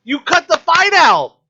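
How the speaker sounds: loud, high exasperated rant; frustrated rant. Retold or imitated. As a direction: yell it in outrage. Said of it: "I'm like".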